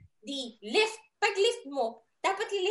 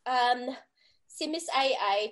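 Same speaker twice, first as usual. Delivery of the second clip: ordinary talk, nothing marked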